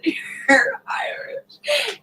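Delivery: high, drawn-out teasing call; teasing singsong. Retold or imitated. Teasing her husband about being Irish, drawn-out singsong. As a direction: stretch it out slowly, poking fun.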